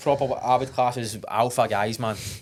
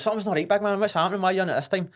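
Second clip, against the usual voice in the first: higher, concerned voice of a mate; imitating a worried mate; 7 semitones higher, faster than usual. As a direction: ask it up high like a worried friend. Friend Dip worried, quoted.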